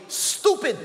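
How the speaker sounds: high, loud mock insult of a parent; imagined parent yelling at a child. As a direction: spit it at the child like a harsh parent.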